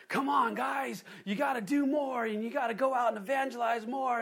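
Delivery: high, hammered-up preacher shout at the flock; fire-and-brimstone push. Retold or imitated. Preacher imitating pastors beating the sheep, mock shouting. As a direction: drive the sheep like an old hellfire preacher. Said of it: "just kind of fire and brimstone".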